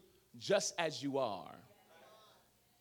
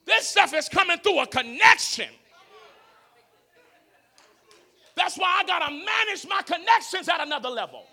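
loud, high, impassioned sermon shout; full-force preaching; 10 semitones higher, 15 dB louder than usual. Real, not acted. Preacher warning that harvest needs relationships, shouting. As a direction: drive it home at the top of the voice.

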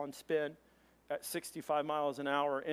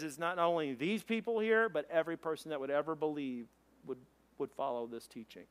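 ordinary talk, nothing marked

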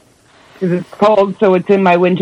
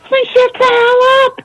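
much higher, drawn-out playful scold; mock scolding squeal; slower than usual. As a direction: squeal it out slowly, teasing. Laughing mock scold at co-host.

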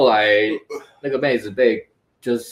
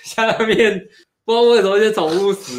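ordinary delivery

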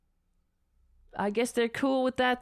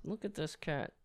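soft cat voice; 3 semitones lower, 11 dB quieter than usual. Real, not acted. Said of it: "Why am I talking like that?".

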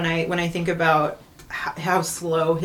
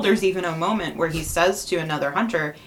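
normal talking voice

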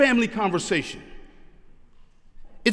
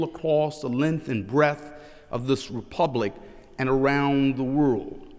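solemn sermon opening; 6 semitones lower than usual. Acted. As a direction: weigh each phrase, with pauses between.

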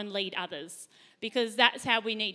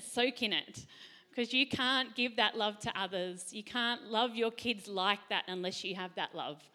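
normal talking voice

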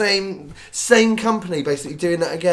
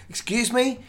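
higher-pitched, affronted question; retold "excuse me" with rising disbelief; indignant query; 8 semitones higher than usual. Customer challenging a dismissive ticket clerk, indignant.

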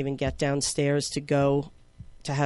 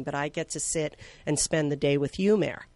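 ordinary talk, nothing marked

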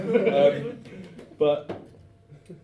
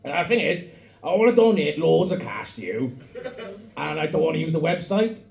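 put-on Welsh accent; 4 semitones higher, 5 dB louder than usual. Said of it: "I'm gonna do it in that fucking hot shit Welsh voice".

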